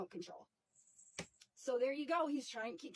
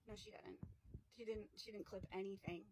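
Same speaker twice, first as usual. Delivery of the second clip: softer, breathy flat denial; quiet denial; 4 semitones lower, 11 dB quieter than usual. Real, not acted. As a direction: quietly set the record straight. Speaker rejects the excuse quietly.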